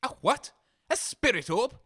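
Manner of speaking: normal talking voice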